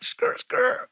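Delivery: high, whispered screeching tyres; tyre screech noise. Retold or imitated. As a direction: make the sound of a car skidding round a bend. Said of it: "so I was like".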